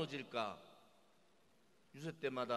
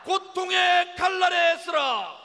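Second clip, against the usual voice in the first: louder, higher, slow chanted slogan; roaring rallying speech; 17 dB louder, slower than usual. Acted. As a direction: bellow the slogan for the crowd to echo. Leader calls chant, crowd echoes. (우렁찬 구호 선창)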